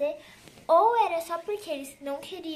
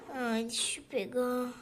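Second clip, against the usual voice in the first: normal talking voice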